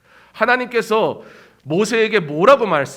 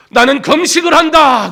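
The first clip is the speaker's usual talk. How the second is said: loud, higher boastful announcement; proud proclamation; 10 semitones higher, 8 dB louder than usual. Retold or imitated. Pharisee proclaiming his fast at the crossroads, loud and proud. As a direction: boast it to the street.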